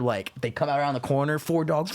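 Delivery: usual speaking voice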